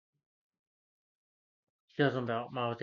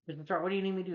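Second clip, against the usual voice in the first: put-on eager kid; 7 semitones higher, much faster than usual. Peter eagerly asks Stark, retold.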